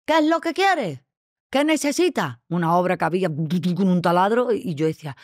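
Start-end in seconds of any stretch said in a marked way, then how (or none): 0.0-2.4 much higher, clipped-off receptionist call across the room; brisk receptionist. Retold or imitated. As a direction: play the receptionist calling from a distance. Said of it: "me dice desde un metro y medio".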